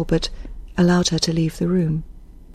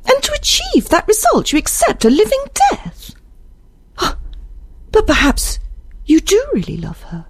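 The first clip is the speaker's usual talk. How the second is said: very high, dismayed character voice; appalled, then searching, pitch held high; anguished Isabel. Acted. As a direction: question him in high-pitched distress. Reader voices distressed Isabel.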